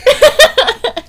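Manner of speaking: a burst of high, loud laughter; high delighted laugh. Real, not acted. Delighted at her British friend's word, laughing high and loud. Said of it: "I just love when they say rubbish".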